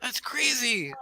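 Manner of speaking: put-on high-pitched excited kid; Tony, amazed. Game master as Tony comparing player to a picture, amazed. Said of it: "He's like".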